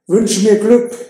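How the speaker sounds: a thrilled call back to his friend; excited. Acted. Romeo springt begeistert aus Kutsche. (Romeo zu Mercutio: begeistert, viel höher und lauter)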